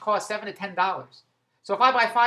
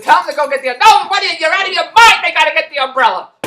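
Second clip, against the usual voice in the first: much higher, louder exasperated comeback; fed-up retort; 8 semitones higher, 12 dB louder than usual. Retold or imitated. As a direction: snap back that she is out of her mind. He argues back on the phone.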